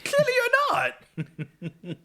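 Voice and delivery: very high, drawn-out laughing jeer; laughing scorn. Real, not acted. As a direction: squeal it slowly through laughter. Mocking the character, laughing.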